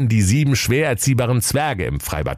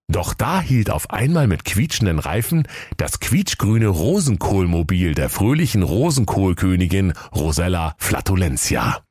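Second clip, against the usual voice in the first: usual speaking voice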